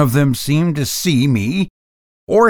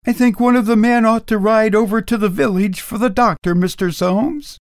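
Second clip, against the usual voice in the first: higher, fast anxious woman's voice; housekeeper voice; 8 semitones higher, faster than usual. A narrator's reading. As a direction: hurry the suggestion nervously.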